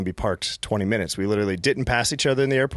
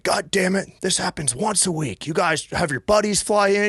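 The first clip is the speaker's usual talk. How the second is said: higher, put-on annoyed cop voice; humorless airport cop; 7 semitones higher than usual. Airport cop to the caller, a fed-up put-on voice. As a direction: grumble it like a fed-up airport cop who sees this weekly. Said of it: "They had no sense of humor about this".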